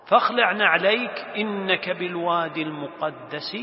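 measured, projected verse reading; Quran recitation. Acted. God's command to Moses read out, reciting. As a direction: recite evenly with sustained tones.

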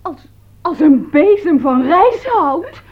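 slow, high, wounded words near tears; tearful outrage. Acted. Hurt maid throws the broom insult back at her mistress, tearful. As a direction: repeat the insult as if about to cry.

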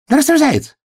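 high, squeaky greeting; excited hedgehog. A narrator's reading. Hedgehog rushes to hug the hare. (радостный тонкий голосок ёжика)

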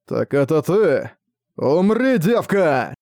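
медленно, затем громкий выкрик; рычащая угроза убить; яростный монстр. Acted. Монстр бросается на Наю. (яростный крик чудовища)

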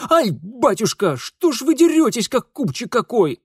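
high, loud yelping protest; pained yelp. Acted. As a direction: yelp as if being swatted by your father. Grown son yelps, struck by father.

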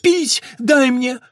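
high, loud frantic demands; desperate, high-pitched shouting; shrieking. A narrator's reading. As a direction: shriek each word as a separate burst. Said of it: "взвизгнула мать".

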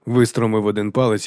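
ordinary delivery, nothing marked

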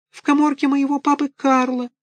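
put-on very high, wailing small-boy voice; pitiful little puppet. A narrator's reading. Buratino pleads with Karabas, high wailing. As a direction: wail like a poor orphan puppet.

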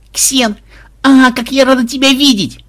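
high, loud delighted greeting; overjoyed squeal. Acted. Granddaughter freed from cell greets Ксен, overjoyed. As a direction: greet a rescuer with gushing joy. Captive joyfully greets her rescuer.

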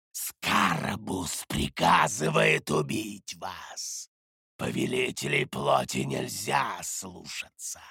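low, slow monstrous voice; demon voice. A narrator's reading. Demon announces the order to kill, in a menacing creature voice. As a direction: intone it as a sinister demon.

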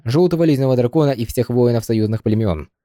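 plain reading voice

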